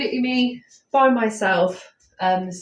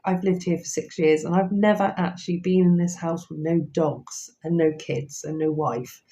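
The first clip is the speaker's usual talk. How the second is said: usual speaking voice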